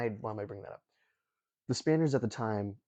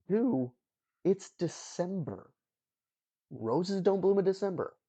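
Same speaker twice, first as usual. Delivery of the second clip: higher and louder, in disbelief; can you believe it, higher and louder; incredulous; 9 semitones higher, 4 dB louder, slower than usual. Real, not acted. Incredulous aside about the roses.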